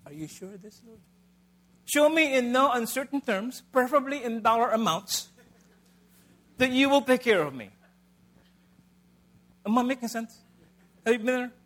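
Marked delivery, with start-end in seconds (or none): 0.0-7.7 a doubter haggling with God for proof; mock demanding doubt. Preacher voicing his own doubt to the Lord, mock demanding. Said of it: "My response".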